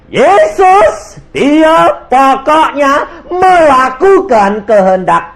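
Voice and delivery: very high and loud, drawn out slowly; slow full-force preaching. Acted. Preacher dwelling on Jesus, high, loud and slow. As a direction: stretch each word out from the pulpit.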